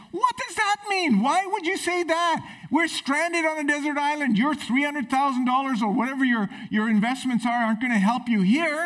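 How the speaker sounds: high, disbelieving outburst; incredulous castaway. Retold or imitated. Stranded man baffled by the rich man's claim, high and incredulous. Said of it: "And the other guy goes".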